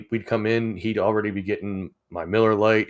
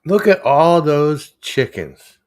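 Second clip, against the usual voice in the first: meme quote; 7 semitones higher, 9 dB louder, slower than usual. Pretend. Quoting a meme line.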